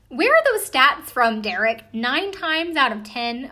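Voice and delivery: loud, high-pitched disbelief on the opening question; incredulous. Real, not acted. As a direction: challenge a dubious claim, dragging out the repeated figure. Host challenges medium's dubious statistic.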